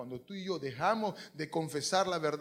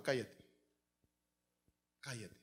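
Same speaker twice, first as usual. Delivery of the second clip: low, soft command for silence; quiet hushing; 7 semitones lower, 11 dB quieter than usual. Acted. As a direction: hush him, fading almost to nothing. God shutting Jeremiah's mouth.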